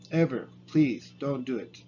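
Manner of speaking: ordinary talk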